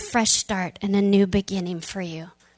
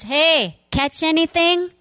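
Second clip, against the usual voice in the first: high, loud call across the water; calling from shore; 7 semitones higher, 5 dB louder than usual. Retold or imitated. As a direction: shout out to the boat, casual. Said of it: "this voice from shore goes".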